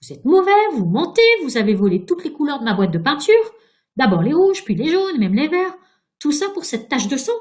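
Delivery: high, loud, indignant tirade; angry accusation. A narrator's reading. Virginia accusing the ghost of stealing her paints, angry accusation. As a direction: hurl each accusation at him. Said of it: "S'écria Virginia".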